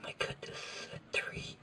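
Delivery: muttered half-whisper to himself. Real, not acted. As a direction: whisper the measurement under your breath.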